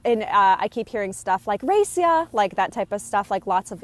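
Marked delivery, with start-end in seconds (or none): 1.6-2.3 high, loud playful taunt; playful challenge. Retold or imitated. Friend daring another to a race, high and loud. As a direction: toss it off like a kid daring a friend. Spirit playing pranks, racing friends.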